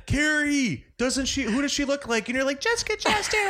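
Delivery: high giddy mock excitement; excited. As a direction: gush it to a friend. Excited celebrity lookalike moment.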